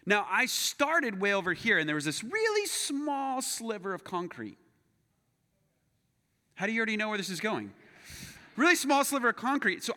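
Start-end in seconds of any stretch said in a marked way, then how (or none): 2.2-4.6 drawn out. Real, not acted. Teases the fateful detail slowly.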